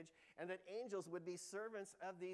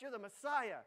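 louder, higher "Messiah" pressed hard; insistent protest; 6 semitones higher, 9 dB louder than usual. Retold or imitated. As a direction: push back urgently at Jesus. Peter protests to Jesus.

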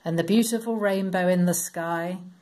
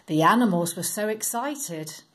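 usual speaking voice

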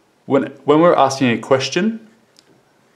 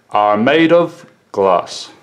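slow, ending down on GLASS; teacher's slow model answer dropping on GLASS; falling answer intonation; slower than usual. Shown as a demonstration. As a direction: stretch it out and let GLASS fall. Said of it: "the intonation must fall".

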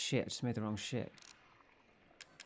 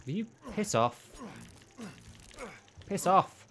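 angry yell at monster; 8 semitones higher than usual. Real, not acted. Player yelling at the chasing monster, exasperated angry shout. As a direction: shout her away in exasperation.

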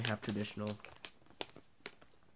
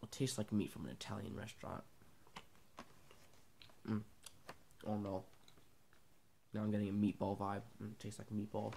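muffled with food; chewing while talking; 4 dB quieter than usual. Real, not acted. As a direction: mouth partly full. Seen 4: Lips pressed, cheeks working between speech; meat pack shown later.

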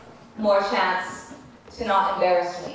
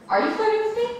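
flirty teasing banter; 12 semitones higher, much faster than usual. Acted. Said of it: "You see what I have to live with".

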